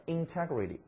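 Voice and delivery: integrity with the stress on the second syllable, in-TEG-rity; stress shown here. Shown as a demonstration. Teacher shows students the stress: stress on TEG. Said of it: "重音在这儿".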